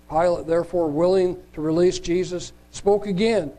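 normal talking voice

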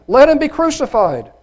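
very high, loud crowd cry. A narrator's reading. Crowd insists to Pilate, crying out even harder. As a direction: push the demand up high and loud. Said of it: "they cried out more".

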